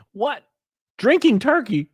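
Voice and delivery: high disbelieving what; baffled, pitch shooting up; incredulous. Real, not acted. Incredulous at chat.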